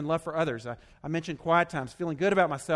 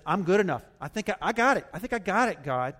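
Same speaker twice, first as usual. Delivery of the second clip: high, loud, cocky claim; prideful self-assurance; 5 semitones higher, 6 dB louder than usual. Acted. Preacher voicing the pride form to God, cocky. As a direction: boast to God with eager mock confidence. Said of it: "the pride form, says".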